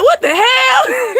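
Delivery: high, slow, crying-out shriek of shock; horrified outburst. Retold or imitated. Watching TV when the lizard crawls up, shrieking in horror. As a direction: yelp it out as if the lizard just touched you.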